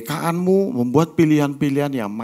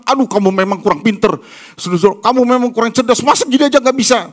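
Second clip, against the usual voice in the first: higher, louder, fast exasperated scolding; scolding parent; 9 semitones higher, 7 dB louder, faster than usual. Retold or imitated. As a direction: parent scolds the child for not being smart, loud and fast.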